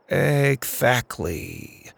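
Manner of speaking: very slow, drawn-out word; uneasy agreement. A narrator's reading. Max agrees with the driver, slowly playing along. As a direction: stretch it out while bluffing.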